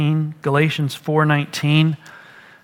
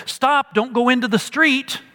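mildly urgent, higher-pitched fatherly warning; father's warning; 7 semitones higher than usual. Acted. A father stopping his child at the street, urgent warning. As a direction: call out a protective warning, pitched up. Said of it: "as a good father say".

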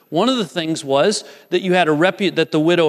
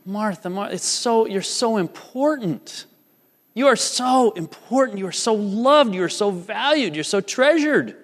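higher, tender words of reassurance; Jesus gently reassuring Martha, higher and tender; 6 semitones higher than usual. Retold or imitated. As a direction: tell her gently how much she matters. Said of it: "he's saying".

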